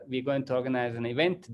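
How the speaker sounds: ordinary talk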